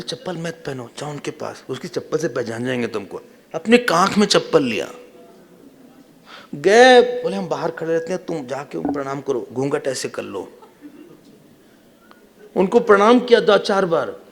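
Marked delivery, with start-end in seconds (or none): 0.0-3.2 soft, conspiratorial undertone; acting out a discreet tip-off; hushed instructions. Krishna secretly instructs Draupadi. 7.4-10.6 softly coaching on the sly; hushed instructions. Retold or imitated. Krishna secretly coaches Draupadi.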